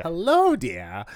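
put-on high, bright old sitcom wife greeting; chirpy old sitcom mom; fifties housewife voice. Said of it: "the fifties housewife voice".

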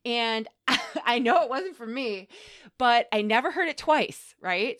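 ordinary talk, nothing marked

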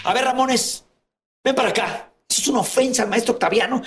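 regaño de maestro, más agudo; maestro regañando. Retold or imitated. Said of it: "le dice". (un maestro regaña a Ramones, agudo)

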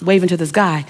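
ordinary talk

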